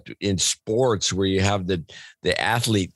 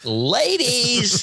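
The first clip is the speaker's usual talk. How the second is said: high, goofy drawn-out shout of ladies!; Jerry Lewis impression; 13 semitones higher than usual. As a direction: squeal it like a zany slapstick comic. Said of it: "it's almost like a Jerry Lewis thing".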